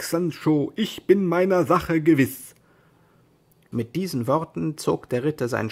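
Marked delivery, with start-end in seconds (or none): 0.0-2.6 louder, insistent assurance; stern certainty. Acted. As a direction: insist firmly and loudly. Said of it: "Don Quixote wurde ernstlich böse".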